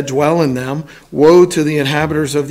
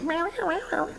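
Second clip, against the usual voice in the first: much higher, much softer squeaky mewing; a tough guy squeaking like a little mouse; tiny mouse voice; 13 semitones higher, 14 dB quieter than usual. Retold or imitated. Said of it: "Sounds like a little mouse".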